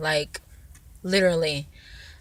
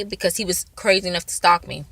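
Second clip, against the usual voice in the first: usual speaking voice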